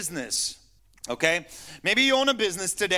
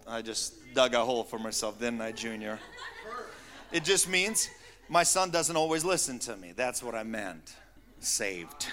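ordinary talk

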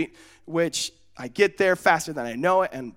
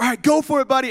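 higher, louder cheering-on; acted send-off; 7 semitones higher, 6 dB louder, faster than usual. Cousin sending a boy off on the bike, higher excited urging. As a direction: urge a kid on.

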